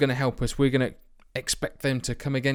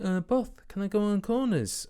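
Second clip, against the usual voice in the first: higher, whiny pestering player voice; mimicking a pleading player; 9 semitones higher than usual. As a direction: nag the boss like a cheeky player. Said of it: "he keeps on coming to me saying".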